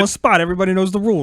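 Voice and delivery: put-on Philly accent. Repeats line in put-on Philly voice.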